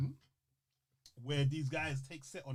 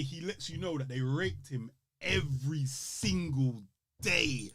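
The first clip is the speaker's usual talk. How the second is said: ordinary delivery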